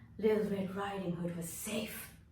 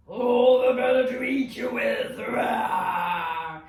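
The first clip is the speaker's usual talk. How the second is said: much louder menacing wolf; big bad wolf roar; 3 semitones higher, 12 dB louder, slower than usual. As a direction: pounce with a loud growl. Wolf reveals itself, roars.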